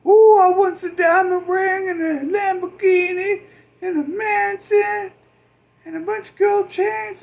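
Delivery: very high, loud whiny wish-list squeal; greedy squeal. Retold or imitated. Spoiled person begging for gifts, very high loud whine. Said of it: "they're like".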